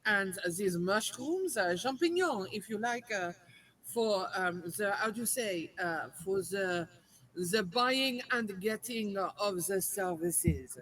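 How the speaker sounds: put-on slow, halting French-accented English. Customer offering money and mushrooms to a ticket tout, French accent. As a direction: grope for the English words in a thick French accent. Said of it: "do it in your French accent".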